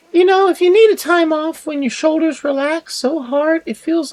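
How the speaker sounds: very high falsetto Aussie woman; a forced, very high Australian villager voice; female Australian accent. Acted. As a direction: squeak it out as an Australian lady. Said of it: "I was trying to do a female Australian accent".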